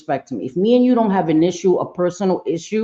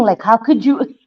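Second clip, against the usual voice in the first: normal talking voice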